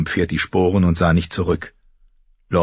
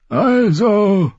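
put-on high, louder old man's announcement; announcing old lord; 14 semitones higher, 3 dB louder than usual. A narrator's reading. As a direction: pronounce it grandly up high. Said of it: "verkündete er".

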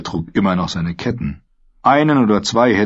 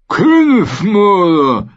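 Königsmörder sehr hoch, laut und langsam, feucht gedehnt; Königsmörder genüsslich sabbernd in die Länge ziehen; sabbernde Ziege; 12 semitones higher, 7 dB louder, much slower than usual. Acted. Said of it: "sabberte er". (Söldnerführer begrüßt den Gefangenen, hoch und sabbernd)

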